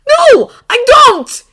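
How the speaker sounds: slow, high, very loud refusal; anguished shout. A narrator's reading. Draco refusing to understand Harry, anguished screaming. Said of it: "Draco called".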